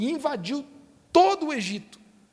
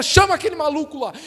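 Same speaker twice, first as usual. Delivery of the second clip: raised, louder mock-desperate command; desperate Pharaoh; 4 semitones higher, 6 dB louder than usual. Pharaoh sending for Moses, acted desperation. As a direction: order it urgently, pitched up. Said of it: "desesperadamente, o faraó manda chamar Moisés".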